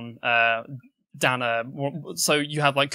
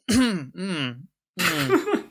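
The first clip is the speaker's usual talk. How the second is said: sniffing halfling hum; 7 semitones higher than usual. Acted. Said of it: "and is like".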